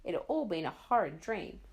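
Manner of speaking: plain reading voice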